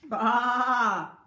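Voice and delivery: loud, bleating baa; sheep bleat. Acted. Teacher voicing the sheep for kindergarten, loud bleating baa. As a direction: bleat it like a little lamb. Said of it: "bleated the sheep".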